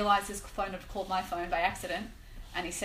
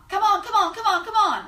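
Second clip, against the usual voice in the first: much higher, louder excited gambler cheering; gambler urging; 11 semitones higher, 9 dB louder than usual. Retold or imitated. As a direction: egg on the slot machine like a hooked gambler. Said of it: "And I hear his voice".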